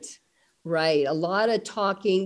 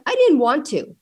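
put-on mild defiant child voice; 7 semitones higher, 5 dB louder than usual. Daughter's defiant reply retold.

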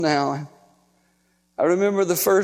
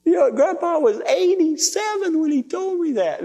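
ordinary delivery

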